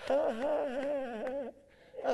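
slow, high put-on "Ha, ha, ha"; mocking doctor laugh. Preacher mimics the doctor laughing at his pain, slow and high. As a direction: imitate the doctor laughing at you. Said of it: "he's laughing at me".

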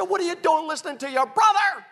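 high, shouted mom scolding; loud, high-pitched mock telling-off; mother hollering. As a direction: imitate a mother yelling at her son. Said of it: "She's hollering at my brother".

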